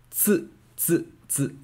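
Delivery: tsu said crisply with a ts, three times; pronunciation demo. Shown as a demonstration. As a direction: show the class how tsu sounds. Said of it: "like this".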